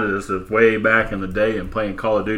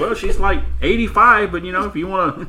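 high mock reply; 8 semitones higher than usual. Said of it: "And I'm like".

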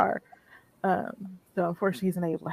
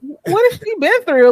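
incredulous; 11 semitones higher, 9 dB louder than usual. Retold or imitated. Reacting to the mom's cold line.

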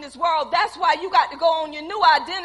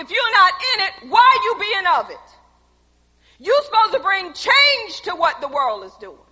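preaching at full force; 5 semitones higher, 9 dB louder than usual. Acted. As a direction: hammer the question home.

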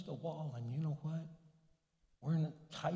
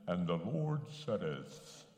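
mock preaching voice; 10 semitones lower, slower than usual. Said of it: "Find yourself a preaching voice".

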